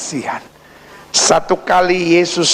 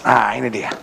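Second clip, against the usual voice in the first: ordinary delivery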